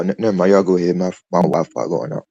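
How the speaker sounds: usual speaking voice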